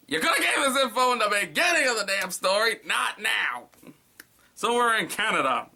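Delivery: high, loud complaint at the author; frustrated outburst about the story; exasperated rant. Real, not acted. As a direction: vent at the writer. Ranting at the story's writer.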